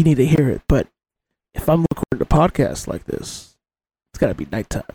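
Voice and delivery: deep, mic-close crooning streamer voice; late-night stream voice. Shown as a demonstration. Still in the Maeve After Dark voice for his co-host. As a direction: stays in the after-dark persona.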